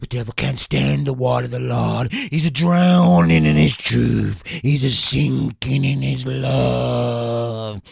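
loud, ecstatic revival preacher; fervent exorcist preacher. Acted. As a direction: roar at the devil, full fervour. Exorcist ritual continues.

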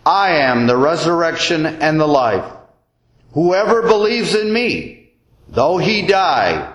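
ordinary talk, nothing marked

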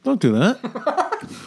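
laughing scold. Shown as a demonstration. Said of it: "I do that to people whenever I get the chance".

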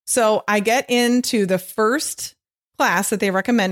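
normal talking voice

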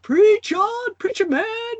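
high, loud, drawn-out holler; mock yell. Pastor's wife wanting to cheer her husband's sermon, yelling. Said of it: "to just yell out".

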